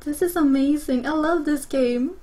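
ordinary delivery

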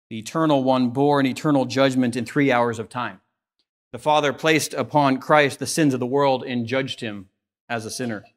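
ordinary delivery

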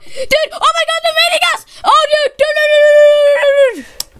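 high, frantic yell as the base gets raided; panicked shouting. Real, not acted. Gamer to teammates while the enemy raids the base, panicked high yelling. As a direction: shout the alarm, stretching out each dude. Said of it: "I'm scared, I'm scared".

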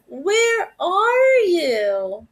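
slow, crying call; dog crying out for his owner in the rain; plaintive cry. A narrator's reading. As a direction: wail out for the lost lady. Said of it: "his plaintive cry".

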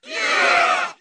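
very loud, raw yell; roaring cheer. Acted. Fighter joining the charge, raw roaring yell. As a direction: blast it out as a war cry. Crowd-style cheer before backing off.

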